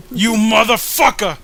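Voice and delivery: loud, high hammed-up curse; mock furious yell. Villain cursing the advice-giver, hammed-up yell. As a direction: scream the insult in cartoon rage.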